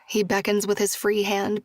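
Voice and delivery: usual speaking voice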